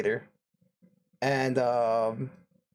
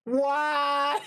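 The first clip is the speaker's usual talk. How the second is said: exasperated laughing whine; 11 semitones higher than usual. Retold or imitated. The narrator seeing her brother arrive too, high laughing wail. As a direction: moan it out half laughing in despair.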